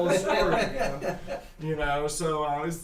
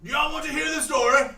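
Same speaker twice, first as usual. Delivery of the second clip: put-on high, loud old man's call; old Frug asking the crowd; 11 semitones higher, 7 dB louder than usual. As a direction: holler it out like an old codger. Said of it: "He goes".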